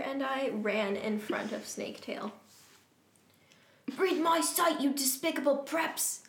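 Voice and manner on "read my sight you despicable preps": higher-pitched villainous taunt without real volume; understated acted shout. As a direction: read a shouted threat, held back. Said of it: "he shouted".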